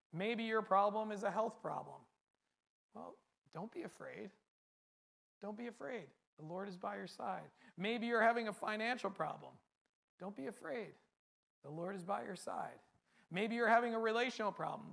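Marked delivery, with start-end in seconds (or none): 2.9-7.5 much softer, gentle and comforting; soft reassurance. Real, not acted. Pastor gently comforting a congregation facing health problems, soft and reassuring. 10.2-12.8 much softer, gentle and comforting; soft reassurance. Real, not acted. Pastor gently comforting a congregation facing health problems, soft and reassuring.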